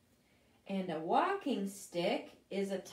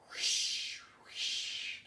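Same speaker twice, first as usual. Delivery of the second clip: breathy wind whoosh. Shown as a demonstration. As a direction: blow it out like wind. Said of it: "Can you make the sound of wind".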